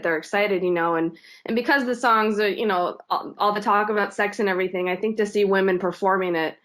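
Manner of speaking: ordinary delivery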